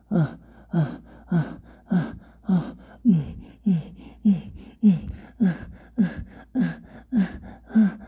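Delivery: high moans in a quick rhythm, building; rapid panting moans. Acted. Narrator acting out quickening exertion, rapid moans. Acted intimate scene, faster moans.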